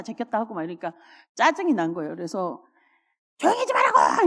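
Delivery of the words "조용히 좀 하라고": high, loud annoyed yell; sister's shout. Retold or imitated. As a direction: snap awake and yell at your noisy sister. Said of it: "소리를 지르는 거예요".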